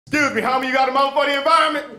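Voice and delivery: a panhandler working the crowd; homeless canvasser. Retold or imitated.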